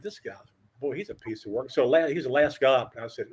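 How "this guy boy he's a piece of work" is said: much softer private grumble; muttered aside. Retold or imitated. Reporter's private aside about Woody Hayes, much softer. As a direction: say it to yourself so Woody can't hear.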